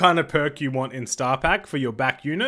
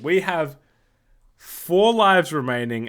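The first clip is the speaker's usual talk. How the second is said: ordinary talk, nothing marked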